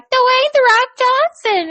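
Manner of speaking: put-on high, slow little-kid voice; drawn-out high child voice; imitating a little kid. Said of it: "was like".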